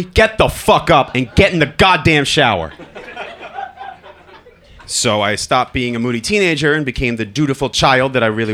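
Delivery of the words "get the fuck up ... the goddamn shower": loud command, laughing through; dad yelling at son to shower; loud order with a laugh. Retold or imitated. Father ordering son out of bed.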